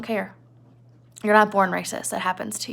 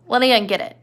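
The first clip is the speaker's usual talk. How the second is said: ordinary talk, nothing marked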